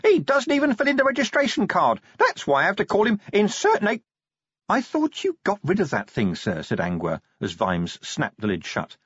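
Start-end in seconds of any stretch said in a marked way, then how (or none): 0.0-4.0 much higher aggrieved imp voice; tiny gadget tattling on its owner; indignant imp. Acted. Imp complains about Vimes. 4.7-6.6 put-on higher, dry young woman's voice; a constable teasing her boss; Angua. Angua speaks to Vimes.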